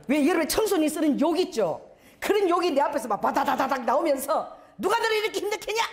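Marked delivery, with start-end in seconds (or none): 4.8-5.8 high, fast outburst. Retold or imitated. Pastor cursing at bullies.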